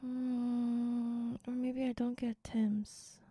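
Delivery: unvoiced whispered musing. Real, not acted. Streamer whispers to herself while deciding food, hushed. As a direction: whisper the thought to yourself. Thinking aloud about breakfast.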